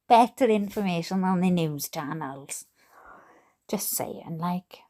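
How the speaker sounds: conspiratorial whispered aside; hushed sidebar. Real, not acted. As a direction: lean in and whisper it to chat. Sly whispered aside to chat.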